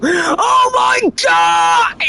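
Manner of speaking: high, drawn-out mock scream of terror; imitated screams. Voicing screams from the boss room, shrieking. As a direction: shriek like a player getting wrecked. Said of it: "This is all you hear".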